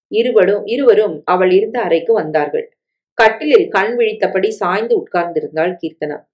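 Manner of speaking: ordinary delivery, nothing marked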